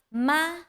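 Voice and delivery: MA held high and level; first tone. Shown as a demonstration. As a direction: say "Ma" on a high, flat pitch. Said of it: "first tone".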